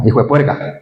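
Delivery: put-on a quick stab at a Santander Colombian accent; lightly put-on Colombian Santander accent. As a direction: toss it off in a Santanderean twang. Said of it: "¿Cómo dicen en acento santanderiano?".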